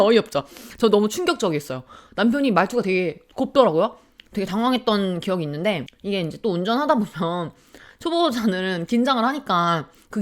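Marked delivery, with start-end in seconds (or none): none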